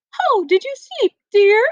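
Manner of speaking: high, loud, sugary queen voice; sweet queen voice. Acted. Teacher voicing the queen asking the princess, high and sugary.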